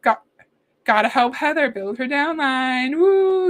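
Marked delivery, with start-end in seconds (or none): 3.0-3.5 loud, high woo of joy; excited whoop. Real, not acted. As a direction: let out a happy whoop. Cheering at a donation.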